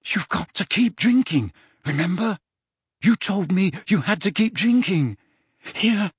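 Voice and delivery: high-pitched, anxious insistence; worried, pressing plea; urgent coaxing. A narrator's reading. Harry urges suffering Dumbledore on.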